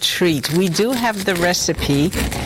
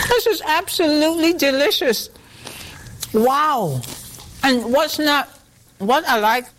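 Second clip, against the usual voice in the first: mouth full; 8 semitones higher than usual. Real, not acted. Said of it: "I'm talking my mouthful".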